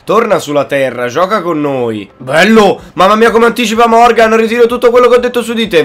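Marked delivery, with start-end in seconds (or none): 2.2-5.8 high, loud rapid praise; excited cheer. Real, not acted. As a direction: burst out in delight. Excited at a great tackle.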